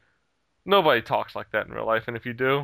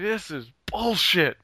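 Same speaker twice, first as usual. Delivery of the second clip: higher, drawn-out retold outrage; flipping out; 6 semitones higher, slower than usual. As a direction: stretch it out in mock indignation. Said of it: "I'm, like, flipping out at this point".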